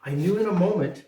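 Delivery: put-on gentle, lilting read-aloud to children; storybook reading voice; story narration. A narrator's reading. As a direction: read it like a bedtime story for the grandkids. Reads Christmas poem to grandchildren.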